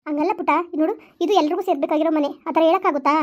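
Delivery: put-on calm a crying child gently; mother consoling her weeping daughter; soothing mother.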